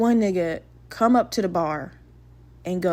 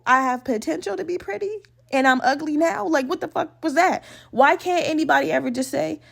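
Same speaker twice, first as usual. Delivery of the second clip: usual speaking voice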